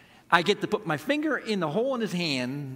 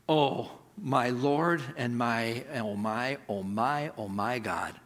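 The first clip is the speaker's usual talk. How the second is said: much lower, softer and slow in awe; awed; 5 semitones lower, 4 dB quieter, slower than usual. Acted. Thomas facing the risen Jesus, hushed, slow awe. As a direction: let the words fall away in wonder.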